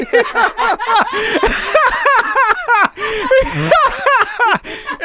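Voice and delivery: long high loud laughing fit; howling laugh. Real, not acted. Telling his friend the cat story, a long loud howl of laughter. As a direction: lose it completely.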